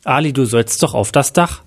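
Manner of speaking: ordinary delivery, nothing marked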